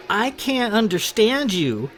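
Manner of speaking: high, loud, mocking yell. A narrator's reading. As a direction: yell it across the table to belittle her. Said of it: "Sarah yelled in her loud voice".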